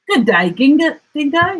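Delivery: a hint of a chirpy platypus. A narrator's reading. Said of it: "he said, snapping his bill".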